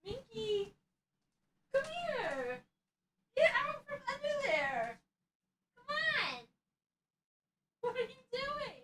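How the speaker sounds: very high, soft, distant pet voice; high faint pleading call; calling a cat. Real, not acted. Calling cat away from mic.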